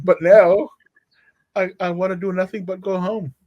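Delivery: ordinary delivery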